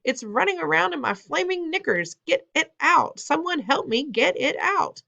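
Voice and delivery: very high hysterical cook; screaming. A narrator's reading. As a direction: squeal in desperate panic. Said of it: "the man was screaming".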